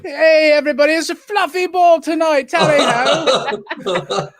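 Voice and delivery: high, loud hammed-up British accent; bad British impression. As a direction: call out like a mock jolly Englishman. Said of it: "I'm just doing a bad impression".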